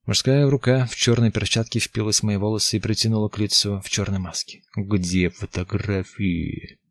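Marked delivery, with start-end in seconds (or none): 4.7-6.8 slow menacing thug. Acted. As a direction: drag out the threat slowly. Intruder interrogating her.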